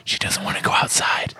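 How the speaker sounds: real whispered aside. Real, not acted. Host whispering about the dog mid-recording. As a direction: hush it under your breath. Commenting during dog chase.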